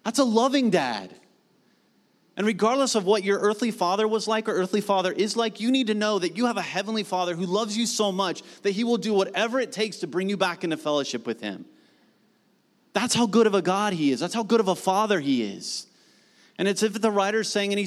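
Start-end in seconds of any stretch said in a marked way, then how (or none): none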